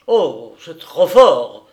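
very loud, drawn-out outrage; vociferating. A narrator's reading. Thwarted husband exploding at the surprise, bellowing. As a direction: bellow it slowly in fury. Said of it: "vociféra Papillot, écumant d'un dépit".

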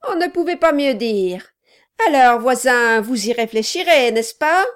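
a loud, pushy little man; gruff gravelly dwarf voice. Acted. Quilp speaks.

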